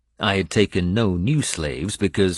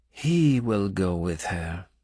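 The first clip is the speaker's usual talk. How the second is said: disapproving; slower than usual. A narrator's reading. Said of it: "she said in a tone of disapproval".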